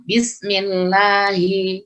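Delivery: slow recited "bismillahi" with "hi" fully sounded; drawn-out chant of "bismillahi"; clear H. Shown as a demonstration. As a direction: hold the long vowel and voice the H in "bismillahi". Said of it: "haknya harus jelas".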